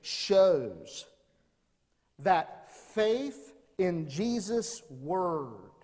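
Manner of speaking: slow, drawn-out key point; slow emphasis. Shown as a demonstration. Pastor stating the sermon's first point slowly. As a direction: let each word land slowly. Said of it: "Number one, the centurion shows".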